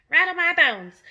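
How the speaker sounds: a parrot going ghostly; spooky parrot. A narrator's reading. The parrot shows off its spooky voice, loud and high. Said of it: "imitation of a spooky voice".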